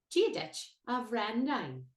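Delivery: very slow "Gia dich a Brendan", B H between W and V; Irish greeting demo. Shown as a demonstration. Teacher to learners, very slow model. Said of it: "B H has a W sound".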